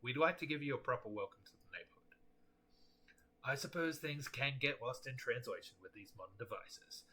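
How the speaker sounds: ordinary talk, nothing marked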